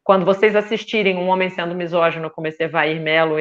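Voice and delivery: usual speaking voice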